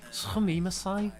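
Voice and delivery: put-on Scouse accent Japanese. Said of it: "like Scouse in Japanese?".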